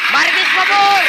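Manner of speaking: loud high call across the room. Real, not acted. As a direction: shout the name out. Calling out over loud noise.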